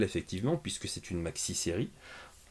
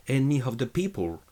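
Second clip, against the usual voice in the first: "Enemy of the people" in a mock American accent, pitched up and louder; bad American accent; 5 semitones higher, 5 dB louder than usual. Shown as a demonstration. French youtuber showing off his bad American accent. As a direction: ham up a clumsy American English accent. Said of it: "n'ont absolument pas amélioré mon accent anglais".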